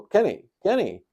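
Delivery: high, loud mock wake-up call; imitating wife waking him. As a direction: call the name as if rousing a sleeper.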